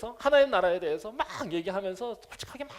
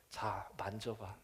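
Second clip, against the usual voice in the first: very slow and much softer; tender invitation; 10 semitones lower, 13 dB quieter, much slower than usual. Acted. 예수님이 의심하는 도마에게 다정하게 권하듯. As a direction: coax him gently, barely above a hush. Jesus invites Thomas to touch.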